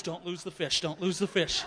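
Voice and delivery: hushed fretful self-talk; anxious inner voice. Retold or imitated. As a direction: repeat it under your breath like a nervous mantra. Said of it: "all I can think in my mind".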